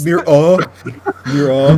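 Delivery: loud, rising "Mirror" that drops the final R; mocking British mirror. Shown as a demonstration. An American mocks his friend's British "Mirror", loud and rising. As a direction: bark out "Mirror" as a Brit would, rising, no R at the end. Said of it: "How are you still making fun of it".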